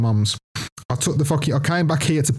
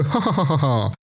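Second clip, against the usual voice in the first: toy bear's laugh; 3 semitones higher than usual. Retold or imitated. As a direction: imitate a talking toy's laugh. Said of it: "then it'd laugh and go".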